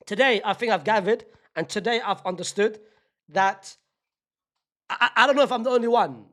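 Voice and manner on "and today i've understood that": usual speaking voice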